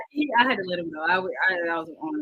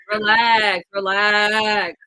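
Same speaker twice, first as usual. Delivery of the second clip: louder, firm repeated relax; 8 dB louder, much slower than usual. Real, not acted. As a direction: raise the voice to make him calm down. Telling caller to calm down.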